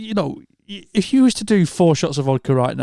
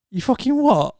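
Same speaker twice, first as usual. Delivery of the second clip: incredulous; 10 semitones higher than usual. Retold or imitated. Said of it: "And I'm like".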